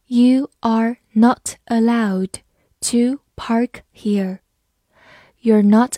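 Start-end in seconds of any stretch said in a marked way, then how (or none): 0.1-4.4 slow, careful reading aloud. Shown as a demonstration. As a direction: read "You are not allowed to park here" slowly. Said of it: "好，慢读一遍。". (慢读)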